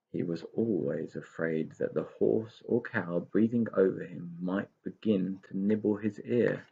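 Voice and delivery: plain reading voice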